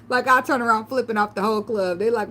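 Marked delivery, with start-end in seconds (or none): none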